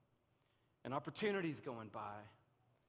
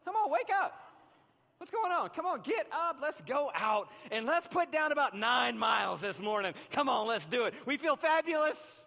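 much higher, louder, overeager cheerleader voice; eager body voice; 10 semitones higher, 9 dB louder than usual. Retold or imitated. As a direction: play the body bouncing out of bed. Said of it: "your body says".

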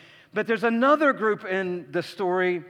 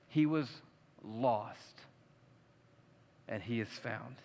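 tender father; 6 semitones lower, 6 dB quieter, slower than usual. Retold or imitated. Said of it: "And the father said".

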